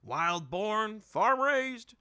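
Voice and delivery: high, loud hawker cry; merchant announcing. A narrator's reading. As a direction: sell it like a market crier, rising. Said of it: "another merchant announced".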